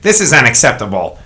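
a hint of an indignant complaint; angry tourist. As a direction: mimic a fussy customer, lightly. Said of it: "he says something so funny".

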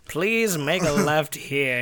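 slow, low, measured GPS voice; a hint of a solemn narrator, slowly; light Morgan Freeman impression. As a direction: softly intone it, drawn out and low. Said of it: "Morgan Freeman's telling me to make a left".

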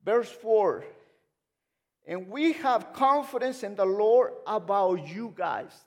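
loud, high, emphatic pulpit delivery; full-force preaching. Real, not acted. Preacher asking church if God is faithful, loud and fervent. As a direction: preach it out big, stretching key words.

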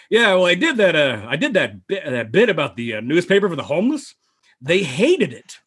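swooping, halting comic impression; Norm Macdonald. Comedian confessing the bit bombed, imitated with swooping pitch. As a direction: imitate a rambling comic, pitch rising and falling. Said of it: "Norm Macdonald comes into Downey's office".

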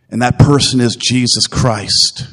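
usual speaking voice

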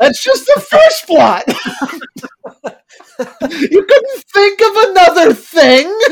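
laughing while complaining; high, loud laughing disbelief. Real, not acted. Critic laughing at a lazy plot, laughing.

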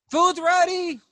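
high, loud call across the room. Acted. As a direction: sing out that the order is up. Cook calls out order.

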